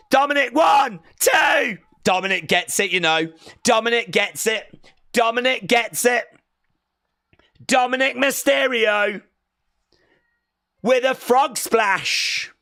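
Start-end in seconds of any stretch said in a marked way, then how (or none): 0.1-1.8 high, loud count; shouted pin count. Real, not acted. 3.6-12.5 high excited victory call; wrestling commentator. Real, not acted. Calling the title win.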